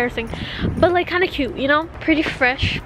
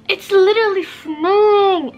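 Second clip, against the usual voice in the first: high, loud delighted cry; excited squeal; 7 semitones higher, 6 dB louder than usual. Real, not acted. Vlogger shrieking with joy at the first snow.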